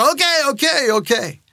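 high, hurried surrender, easing down; giving in. Retold or imitated. Dad gives in to chanting kids, acted surrender. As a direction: cut off the clamor, exasperated.

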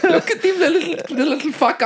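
very high, laughing South African impression; giggly, hammed-up South African accent; mock South African accent. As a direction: squeal a South African movie quote while laughing. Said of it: "It's tough accent".

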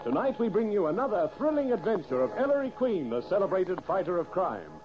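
ordinary delivery

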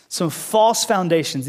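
slow repeat with "false" stressed and falling; emphatic repeat. Shown as a demonstration. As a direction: hammer home "false".

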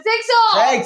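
playful loud shout. Pretend. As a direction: yell it out for fun. Group shout.

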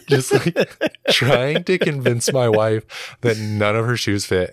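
high, laughing through the words; can barely get it out for laughing. Real, not acted. Dad laughing as he retells his daughter's shoe trick, words squeezed out high through laughter.

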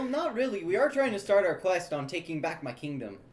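ordinary delivery

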